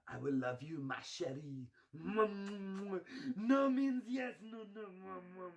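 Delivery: mock French seducer, slow and high on no means yes; French accent. Hammed-up French lover wooing, mock French accent. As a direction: purr it like a cheesy French lover.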